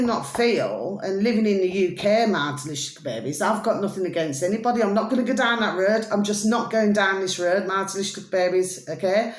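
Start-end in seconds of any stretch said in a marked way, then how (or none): none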